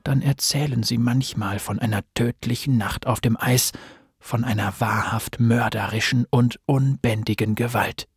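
low, hushed, ominous storyteller; horror trailer voice. A narrator's reading. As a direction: tell it like a dark campfire legend. Dark legend told at fireside. (tiefe, gedämpfte Horror-Erzählstimme)

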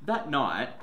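plain reading voice

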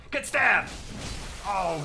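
loud triumphant yell. Real, not acted. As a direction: shout it as you strike. Gamer yelling at enemy mid-fight.

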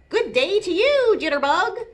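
high, loud, jolly hello; hearty otter greeting. A narrator's reading. Duffles the otter greeting Jitterbug, hearty.

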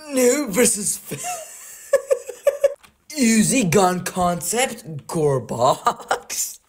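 slow words through giggles; laughing. Real, not acted. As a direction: read it out while cracking up. Laughing while reading weapon names.

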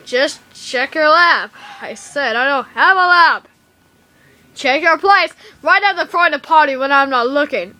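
heated, insistent yelling; exasperated shouting. Acted. Acted quarrel over lab and party.

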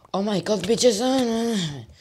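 high-pitched yelling outburst; excited screaming. Real, not acted. Gamer reacting to a shock in his match, excited screaming. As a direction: scream it out high, then drop on the last word.

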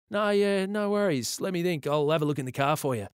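high, easygoing officer voice; obliging police officer. A narrator's reading. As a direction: start high and loud, then hurry on helpfully.

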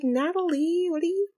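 put-on high, gushing surprise at an old friend; excited gushing greeting. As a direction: squeal it like spotting an old roommate. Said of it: "She's like".

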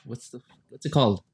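ordinary talk, nothing marked

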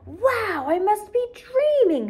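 high, slow amazed gasp of words; painter's wide-eyed wonder. A narrator's reading. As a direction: act stunned with delight. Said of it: "make ... faces".

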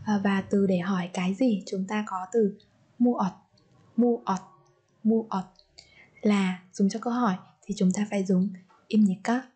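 usual speaking voice

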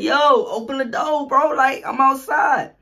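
high, slow yell through the door; shouting to be let in. Retold or imitated. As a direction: call out loud to sister outside. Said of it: "I'm yelling her name".

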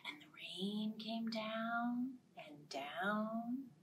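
slow whispered rain. A narrator's reading. Storyteller whispering the rain. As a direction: hush it down.

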